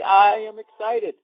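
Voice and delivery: stretched-out, hammed-up excited voice; mock excitement. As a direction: announce excitement in drawn-out mock earnest. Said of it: "went up as if to say".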